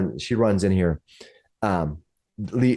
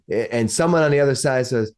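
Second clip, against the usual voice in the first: ordinary delivery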